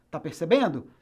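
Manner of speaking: ordinary talk, nothing marked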